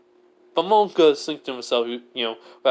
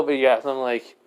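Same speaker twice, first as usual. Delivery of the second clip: usual speaking voice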